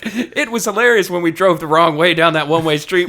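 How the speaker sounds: mock the author's glee through laughter; amused impression of a gleeful wrestler; laughing mock quote.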